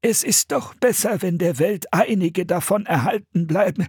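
put-on high, trembling old monk; shaky, pleading elderly voice; Bruder Michael. A narrator's reading. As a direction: insist in a quavering voice. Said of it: "rief eine zittrige Stimme".

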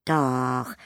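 light it up with joy; beaming. A narrator's reading. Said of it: "strahlte das Tingeli".

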